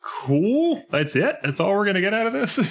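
incredulous. Retold or imitated. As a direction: sound let down and baffled, rising high. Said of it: "Which is just like".

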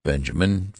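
usual speaking voice

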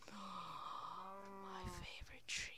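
slow, drawn-out whisper; hushed lament. Pretend. Player grieving a favorite tree, slow hushed whisper. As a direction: whisper it like a mock mourning.

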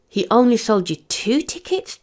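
high, incredulous question; disbelieving, rising to the end; dismayed. A narrator's reading. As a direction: let the pitch climb in dismay. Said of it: "Tommy asked dismayed".

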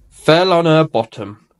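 ordinary delivery

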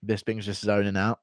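ordinary delivery

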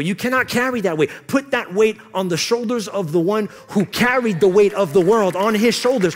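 loud, high, impassioned preaching; full-force preaching. Real, not acted. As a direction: drive each line home to the whole room.

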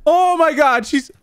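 high, frightened, breathless gasps; real scare. Real, not acted. Gamer spooked by mother in bedroom, high frightened voice. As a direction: freak out because she's in the room. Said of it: "I got teary eyes".

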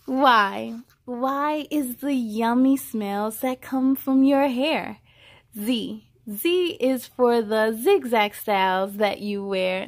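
put-on bright, measured read-aloud voice for a child; storybook reading. A narrator's reading. Reading a hair alphabet book aloud to a child, storybook voice. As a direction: read each line out like a picture book.

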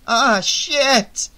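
loud, high-pitched alarm; panicked, high outburst; emphatic gasp. A narrator's reading. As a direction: exclaim it in sudden dismay. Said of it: "Shelley gasped with emphasis".

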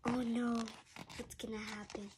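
ordinary delivery

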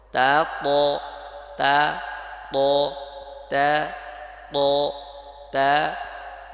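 loud, separated thin তা and heavy rounded প; emphatic model of light তা versus heavy প; pronunciation drill. Shown as a demonstration. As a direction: drill তা then প, each held and distinct. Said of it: "যেমন".

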